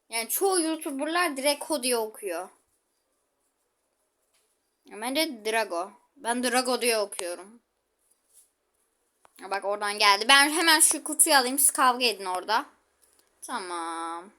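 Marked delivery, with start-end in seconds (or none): none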